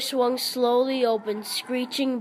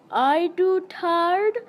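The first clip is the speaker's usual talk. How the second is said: much higher put-on girlish voice; shrill girlish voice; 7 semitones higher, slower than usual. Billy calling after the fleeing boys in a girlish voice. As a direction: tease the boys as a silly girl.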